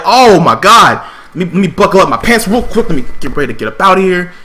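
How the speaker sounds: loud startled gasp of alarm, then rushing; mock panic, hurrying to get out; panicked inner voice. Retelling his panic at hearing daddy.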